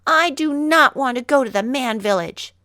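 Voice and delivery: high, loud defiant refusal; crying out. Acted. Mowgli tells Bagheera he won't go, high loud defiance. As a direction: stamp it out like a stubborn child. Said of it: "Mowgli cried".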